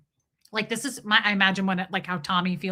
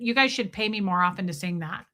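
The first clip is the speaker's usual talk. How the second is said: ordinary talk, nothing marked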